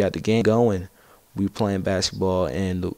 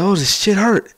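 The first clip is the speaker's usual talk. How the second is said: much higher, louder whiny complaint; mock whining; 12 semitones higher, 6 dB louder than usual. Injured friend griping after the fall, in a high mock whine. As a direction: imitate a tough guy moaning about his ankle. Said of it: "he was, like, whining".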